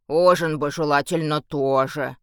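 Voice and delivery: a hint of a dopey orc; dopey deep voice. A narrator's reading. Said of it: "пробасил орк". (лёгкий басок орка)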